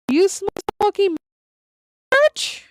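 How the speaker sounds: a hammed-up furious wife scolding; angry wife. Wife berating him in church.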